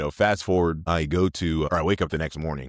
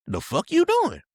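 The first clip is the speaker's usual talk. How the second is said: high, loud retold outburst; mock-indignant squawk up high; indignant disbelief; 6 dB louder, slower than usual. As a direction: act out being loudly taken aback. Acting out an indignant confrontation.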